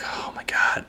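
whispered exasperation; exasperated whisper. Retold or imitated. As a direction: breathe it out under your breath. Annoyed inner reaction.

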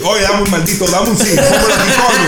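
high, loud Dominican Spanish rant, half laughing; mock yelling in Dominican slang. Telling off the French phone-shop lady in a laughing mock yell. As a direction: fire off the slang like you've lost it. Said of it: "start yelling at her in Dominican slang".

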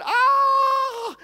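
high piercing mock scream; hammed-up girl's scream; imitated scream. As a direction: shriek like a girl who found a leech. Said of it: "shouted at the top of her voice and screamed".

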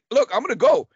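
mildly panicked self-quote; freaking out. Retold or imitated. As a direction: replay being overwhelmed, pitch pushed up. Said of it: "I'm, like, freaking out".

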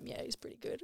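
hushed, breathy appraisal; sly whispered approval; whispered verdict. Retold or imitated. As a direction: whisper it like a secret judgement. Voicing the couple eyeing a photo.

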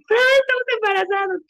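high-pitched re-enacted joyful cry; excited announcement. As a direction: call out the big news to a partner, high and thrilled. Retold shouted announcement to partner.

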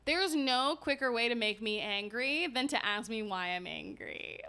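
normal talking voice

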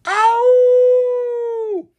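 high, loud wolf howl; long animal howl; werewolf howl. Acted.

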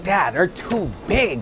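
a lightly voiced two-year-old, slow and wondering; slow, hushed little girl. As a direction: say it slowly like a small child in awe. Daughter quoted describing the presence.

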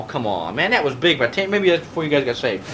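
normal talking voice

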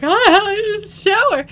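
high, loud mock wail; retold frantic outburst. Woman running upstairs past her stunned partner, mock frantic cry. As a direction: blurt it out high while fleeing.

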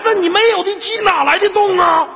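high, loud tirade; mock-angry scolding. Host scolding caller for getting excited, mock-angry. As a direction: rant at them in comic exasperation.

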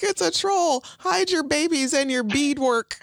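hammed-up alarm; panicked villager. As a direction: cry out like fleeing townsfolk. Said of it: "they're like".

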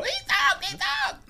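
very high mock-girlish shout; girls' shrieking. Girls telling on the talking bird, shrieking. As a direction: squeal it loudly in falsetto. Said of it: "And then they go".

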